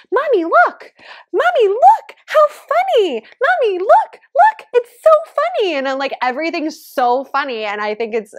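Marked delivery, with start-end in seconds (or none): 0.1-5.7 very high, loud imitation of a little kid; excited toddler. Toddler pointing things out to mommy, imitated in an excited high voice. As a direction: squeal it like a toddler demanding attention. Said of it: "he points at everything and he goes".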